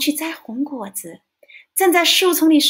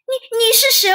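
startled; 10 semitones higher, 5 dB louder than usual. Acted. Dinosaur wakes, startled by stranger.

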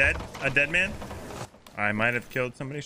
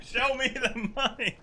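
higher, pumped-up movie line; movie quote shout; 10 semitones higher than usual. Acted. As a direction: yell the quote with excitement. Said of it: "Give me some movie quotes".

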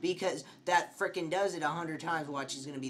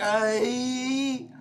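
high panicked shriek; scream; 9 semitones higher, 8 dB louder than usual. Real, not acted. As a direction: let out a terrified yelp. Scream while fleeing.

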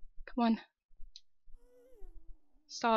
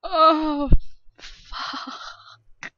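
whimpering cry; 3 semitones higher, 7 dB louder than usual. Real, not acted. Crying out.

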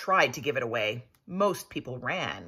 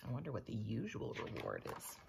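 ordinary delivery

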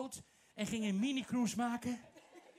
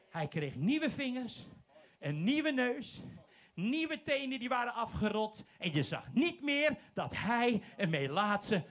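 normal talking voice

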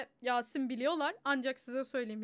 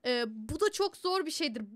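ordinary talk, nothing marked

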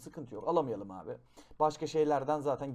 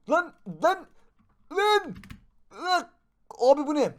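much higher, louder alarmed yelps; startled panic; 11 semitones higher, 7 dB louder than usual. Real, not acted. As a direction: yelp in shock as it hits you. Suddenly attacked by creature.

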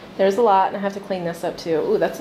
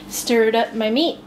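ordinary talk

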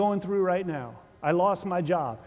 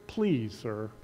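sarcastic; 7 semitones lower, 4 dB quieter than usual. Retold or imitated. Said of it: "And I hear sarcasm".

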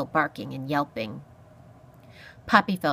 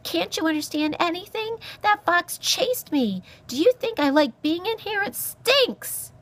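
very high squeaky protest, getting louder; exasperated mouse; 13 semitones higher, 3 dB louder than usual. A narrator's reading. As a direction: build to an indignant squeak. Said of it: "an exasperated Poppy replied".

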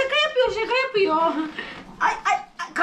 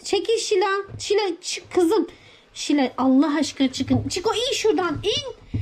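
ordinary talk

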